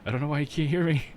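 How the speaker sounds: high-pitched retold protest; retold exasperation. Streamer retelling a dispute with chat, exasperated. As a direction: plead to be heard, retelling it.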